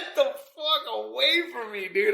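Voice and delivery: high, slow, laughing shout; laughing yell. Retold or imitated. Backing away from the stranger, high laughing yell. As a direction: yell it while cracking up. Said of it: "I was laughing".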